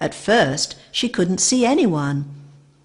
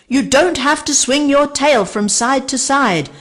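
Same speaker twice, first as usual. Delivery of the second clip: higher, louder, arguing; 8 semitones higher, 5 dB louder than usual. A narrator's reading. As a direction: argue the point crossly. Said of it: "argued Archie".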